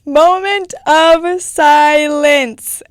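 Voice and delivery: milk it like a fake eulogy; hammed-up solemn pause for the quote; mock solemn. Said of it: "calls for mock silence".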